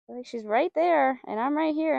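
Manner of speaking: ordinary delivery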